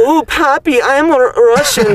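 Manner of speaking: high-pitched, slow Russian accent; hammed-up Russian accent, drawn out and high. Shown as a demonstration. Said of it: "you guys wanna hear a really good Russian accent".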